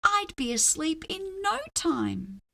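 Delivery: ordinary delivery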